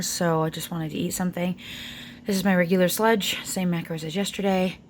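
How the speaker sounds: ordinary talk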